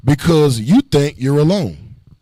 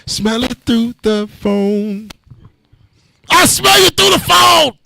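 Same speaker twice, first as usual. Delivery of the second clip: TikTok guy impression; 6 semitones higher, slower than usual. Preacher mimicking a TikTok guy smelling booze, building to a shout. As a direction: build from a slow drawl into a yell. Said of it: "you ever seen that dude on TikTok".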